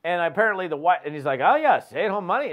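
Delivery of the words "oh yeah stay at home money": higher, louder mock-eager reply; imitating a prospect happily taking the bait; amused enthusiasm. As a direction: play along, tickled by the offer. Prospect reacting to the funny pitch.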